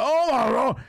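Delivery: high-pitched mock scream of horror; retold scream. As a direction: imitate a man crying out over his wrecked truck. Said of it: "he darted out that door screaming".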